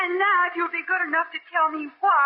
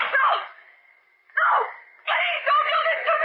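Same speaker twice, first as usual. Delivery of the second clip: high, shouted desperate plea; terrified screaming; 9 semitones higher than usual. Acted. Victim begging not to be thrown off train, terrified screaming. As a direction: scream for your life.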